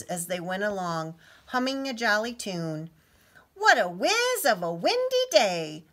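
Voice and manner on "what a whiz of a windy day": high, loud, thrilled; excited cry. A narrator's reading. Child calling to friends about the wind, excited. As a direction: shout it out with delight. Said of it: "cried Little Blue excitedly".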